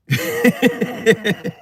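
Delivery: high giggly laugh; amused, high laughter. Real, not acted. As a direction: break into a high-pitched chuckle. Amused at his own candour.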